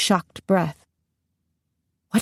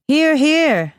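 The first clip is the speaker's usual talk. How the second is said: high, raised cheer of approval; rousing cheer; 8 semitones higher, 4 dB louder than usual. A narrator's reading. Angela, golden eyes wide, backing the queen, rousing cheer. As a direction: cheer the speech on.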